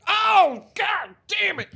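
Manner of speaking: sudden high, loud yelp of dismay; mock panicked yell. Man hit by a trank dart yelling in mock panic. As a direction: shriek it like you just got caught. Tribe victim caught by darts.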